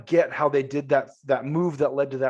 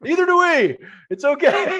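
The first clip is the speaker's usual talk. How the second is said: very high, louder, laughing comfort; laughing reassurance; 7 dB louder than usual. Retold or imitated. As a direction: reassure him through a laugh. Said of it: "And you're like".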